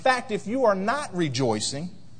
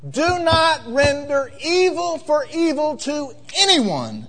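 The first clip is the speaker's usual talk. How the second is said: slow, higher, weighty quoting of scripture; solemn scripture reading; 8 semitones higher, 4 dB louder, slower than usual. Acted. Pastor reads the verse to the congregation, slow and solemn. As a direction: lean into each word of the verse.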